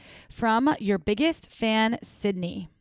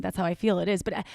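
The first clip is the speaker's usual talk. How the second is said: ordinary talk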